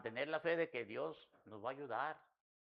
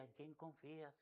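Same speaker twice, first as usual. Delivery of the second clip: much softer "¿En quién confías?", the wife's quiet reminder; soft gentle question; 14 dB quieter than usual. Retold or imitated. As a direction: murmur it tenderly. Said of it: "Y me decía ella".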